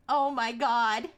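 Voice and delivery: ordinary talk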